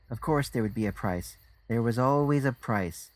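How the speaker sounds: plain reading voice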